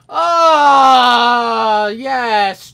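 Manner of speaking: long, high, very loud wail; frustrated yell. Real, not acted. Player losing it at the game, frustrated yell. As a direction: let out a drawn-out yell of frustration.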